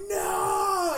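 high, loud shout of dismay; anguished no. Retold or imitated. Watching vomit land on his Xbox, loud high shout. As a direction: cry out as the vomit hits.

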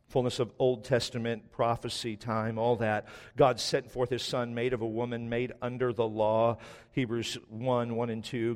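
low, soft measured reading of scripture; a preacher softly reading out Bible verses. Acted. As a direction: read the verses quietly and evenly. Reads scripture aloud.

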